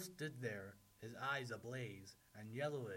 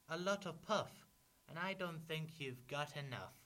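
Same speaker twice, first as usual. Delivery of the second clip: high, loud piglet retort; defiant pig; 6 semitones higher, 5 dB louder than usual. A narrator's reading. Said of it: "pig said".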